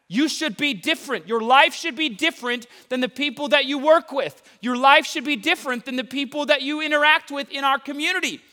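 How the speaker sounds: high, loud passionate preaching; outdoor voice indoors. Real, not acted. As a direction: yell it like a fired-up preacher. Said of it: "why are you yelling at us?".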